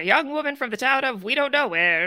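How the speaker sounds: put-on brisk old-time radio announcer; 1920s newscaster voice. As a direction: read it like a 1920s newsreel. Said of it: "in this kind of newscaster voice".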